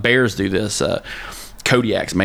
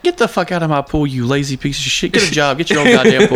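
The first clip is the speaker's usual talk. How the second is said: higher, building to a loud yell; yelling at bears; 6 semitones higher, 4 dB louder than usual. Retold or imitated. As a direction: chase them off with rising anger. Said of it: "you're just like".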